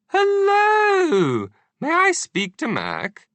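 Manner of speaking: very high, fluting royal voice; Queen of England. A narrator's reading. Queen greeting the agent over the phone in a regal voice. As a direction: play a prim elderly queen.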